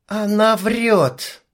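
ordinary delivery, nothing marked

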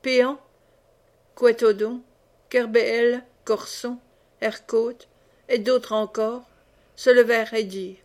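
plain reading voice

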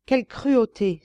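exclamation forte et haute; s'écrier d'un ton outré; cri indigné. Acted. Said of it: "s'écria-t-elle". (s'écrier avec indignation)

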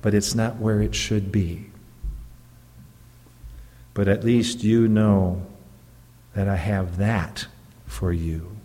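ordinary delivery

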